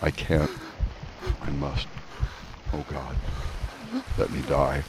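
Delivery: low, soft, despairing moans; moaning in misery. Acted. Drowning man begging to die, moaning softly. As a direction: give up hope, fading to nothing. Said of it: "he moaned in bitter misery".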